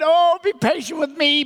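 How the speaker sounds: high, mock-desperate plea; begging. Servant on his knees before his master, hammed-up begging. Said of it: "he begged".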